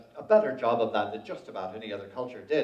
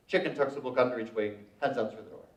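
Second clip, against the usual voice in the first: ordinary talk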